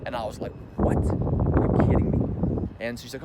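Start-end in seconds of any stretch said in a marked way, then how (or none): 0.8-2.7 shocked disbelief. Retold or imitated. Hearing she was undressed.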